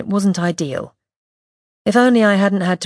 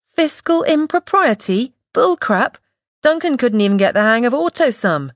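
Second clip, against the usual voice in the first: incredulous scoff; 6 semitones higher than usual. A narrator's reading. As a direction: scoff at the ridiculous idea. Widow scoffs at the claim.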